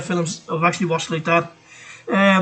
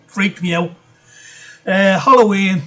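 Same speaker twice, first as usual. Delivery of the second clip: ordinary talk, nothing marked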